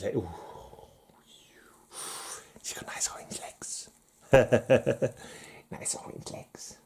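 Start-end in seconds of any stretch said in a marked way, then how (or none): 2.6-3.9 unvoiced sly whisper; hushed creepy whisper. Whispered lewd aside. 5.7-6.8 unvoiced leering whisper; faint sleazy whisper. Whispered again.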